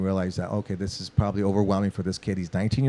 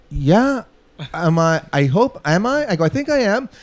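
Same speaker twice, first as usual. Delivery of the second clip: higher, unsure and jittery; nervous reply; 7 semitones higher, 5 dB louder than usual. Retold or imitated. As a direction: answer like a scared kid hoping he's fine. Nervous young drummer answers.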